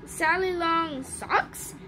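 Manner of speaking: slow guess, rising on "socks"; unsure question. Acted. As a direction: draw it out and lift "socks" doubtfully. Unsure guess at magic word.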